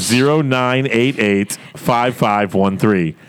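slow, careful read of the digits; slow dictation. Shown as a demonstration. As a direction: dictate slowly so people can write it down. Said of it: "Just slow, slow down just a little".

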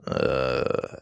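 low rough raptor sound; velociraptor noise. Pretend. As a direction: rasp out a raptor call. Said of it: "velociraptor noises such as".

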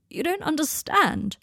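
high, slow, anguished protest; cracking cry. A narrator's reading. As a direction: cry it out, breaking on the last word. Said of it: "his voice cracking on the last word".

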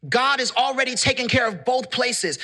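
usual speaking voice

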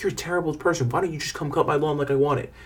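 soft, high mock yelling; mock screaming client. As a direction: mimic an outraged client without raising the volume. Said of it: "they scream at you".

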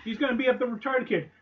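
normal talking voice

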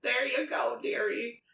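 lightly voiced condescending granny; pat-your-hand old lady tone. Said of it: "I love your old lady voice".

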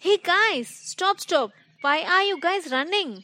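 high-pitched urgent hail; calling to stop. Acted. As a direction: call runners to a halt, then a quick question. Monkey calls runners to stop.

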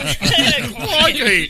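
high, loud mock Aussie exclamation; playful Australian accent, pitched high. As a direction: yelp it like an Australian croc hunter. Joining mock Crocodile Hunter catchphrase.

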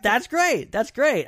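loud, high, delighted; excited praise. Real, not acted. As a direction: cheer it on for a friend. Cheering friend's podcast on list.

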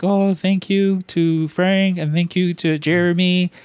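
high, slow, syrupy mock gratitude; mock sappy thanks. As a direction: parody an over-sweet thank-you speech. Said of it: "I can't sit there and be like".

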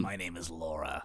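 low, quiet put-on host voice; deep hushed intro voice; mock presenter. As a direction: play a soft-spoken TV host. Mock show intro continues.

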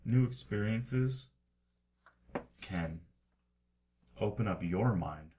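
loud, portentous proclamation with long pauses; oracular book reading. Acted. Game master answers the Book of Alan consultation, oracular proclamation. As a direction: intone it like a wise old book.

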